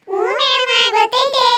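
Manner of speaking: put-on high, loud childish reply; eager schoolchild. Pupils answering madam about the game, high loud shout. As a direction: call it out like a keen pupil.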